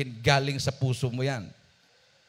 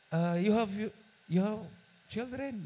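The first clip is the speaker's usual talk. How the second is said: gentle, higher caring question; softened voice; 8 semitones higher than usual. Retold or imitated. Employer gently asking the maid about her family. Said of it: "Lumambot ang boses".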